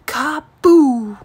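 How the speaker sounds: loud boomed "Kaboom!"; booming thunder. A narrator's reading. Narrator booming the thunderclap to the listening kids. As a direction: make it the thunder crashing.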